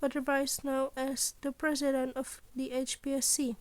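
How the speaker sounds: ordinary delivery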